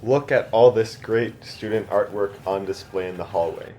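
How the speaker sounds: usual speaking voice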